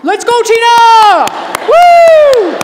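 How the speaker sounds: cheering loudly at a rally; enthusiastic yelling. Retold or imitated. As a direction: yell like an overexcited fan in the crowd. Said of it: "He was literally yelling".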